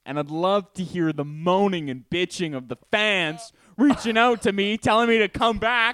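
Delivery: high, loud smug taunt; smug boasting. Pretend. Mock feud between hosts.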